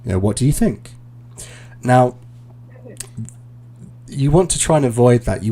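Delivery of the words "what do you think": "think" lifts upward as a genuine question; question rise. Shown as a demonstration. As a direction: let "think" rise at the end, like asking. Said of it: "is when it's a question".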